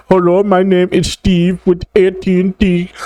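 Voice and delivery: sing-song helpful service rep voice; mock customer service rep.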